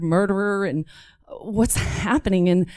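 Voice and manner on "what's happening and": plain reading voice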